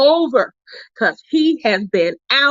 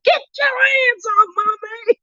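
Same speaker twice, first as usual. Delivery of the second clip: teasing high holler; retold yell; 13 semitones higher than usual. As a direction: yell it as a playful warning, pitched way up. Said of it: "and I yelled".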